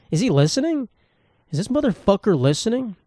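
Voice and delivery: high-pitched imitated suspicion; mock-alarmed, high questioning; suspicious questioning. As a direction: ask it high, as if catching an eavesdropper. Retelling suspicion of lurking neighbor.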